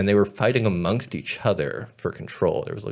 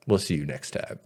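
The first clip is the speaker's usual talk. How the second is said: low brush-off; 7 semitones lower, 9 dB quieter than usual. Retold or imitated. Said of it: "they're like".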